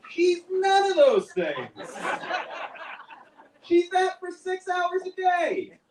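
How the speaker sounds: high, slow, stretched-out protest; comic outburst. Acted. Comic husband contradicting praise of his wife, exasperated mock yell. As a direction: raise the pitch and drag out every word.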